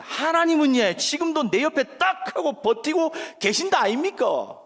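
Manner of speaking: loud, high Gyeongsang outburst; punchy Gyeongsang-accented insistence; Gyeongsang dialect. Shown as a demonstration. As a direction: proclaim it in emphatic Gyeongsang dialect. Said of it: "저 경상도 사람입니다".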